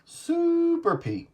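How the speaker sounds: hammed-up echo of the call; mock catchphrase. Young friend joining in with a high stretched mock call.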